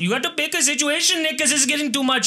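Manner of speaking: put-on high, lilting comic Indian accent. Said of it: "I like an Indian accent".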